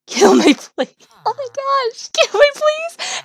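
high, drawn-out mock plea; high, pleading wail; desperate begging. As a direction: beg hammily to be put out of your misery. Trapped with annoying pal, begs for death.

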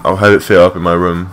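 ordinary talk

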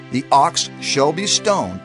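ordinary delivery

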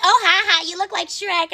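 high imitation of teasing boys; mock jeering. Boys laughing at Emily over webcam, mock jeering. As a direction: mimic their taunt, pitched well up.

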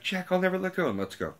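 high, lightly mocking Rose voice. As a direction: parody the heroine's vow in a high voice. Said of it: "she's like".

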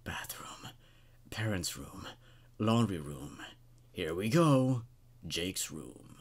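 soft, creepy whisper of each room; hushed intruder whispering room names. A narrator's reading. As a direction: murmur the rooms like an intruder counting them off. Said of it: "you could hear him whispering".

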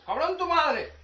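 loud, high offended comeback; angry retort. Pretend. Taking the insult personally, yelling back angrily.

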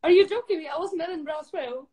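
high laughing disbelief; amazed at the coincidence; surprised. Real, not acted. Surprised at same trip.

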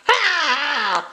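short high gremlin screech; a quick startled creature squeal; gremlin shriek. Acted. Gremlin voice lets out a cry.

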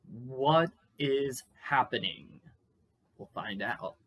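ordinary delivery, nothing marked

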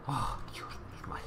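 breathless whispered relief; relieved whisper. Real, not acted. Relief after danger.

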